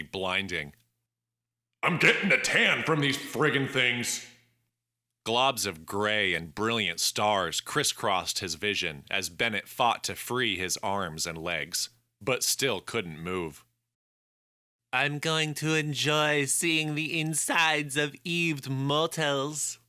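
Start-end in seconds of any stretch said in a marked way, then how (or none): 1.8-4.2 put-on higher, griping young man; annoyed young man voice. Hero complains under hot lamps. 14.9-19.8 put-on slow, high, nasal French accent. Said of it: "a nauseating, nasally voice with a French accent said".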